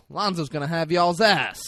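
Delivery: tag. Retold or imitated. Acts out Roger's warning.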